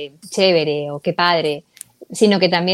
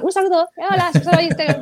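high, bright pretend hello to listeners; high pretend greeting through the GPS; cheerful greeting; 10 semitones higher than usual. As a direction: greet them warmly from the app. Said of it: "Ojalá pudiera mandarles un saludo".

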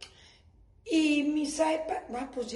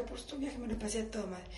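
ordinary talk, nothing marked

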